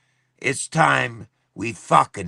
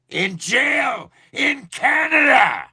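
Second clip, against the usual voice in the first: high, slow furious yell; angry shout. Real, not acted. Ranting streamer yells at his live viewers, furious shout. Angry livestream rant about Canada.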